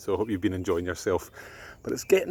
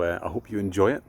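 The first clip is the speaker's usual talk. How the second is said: plain reading voice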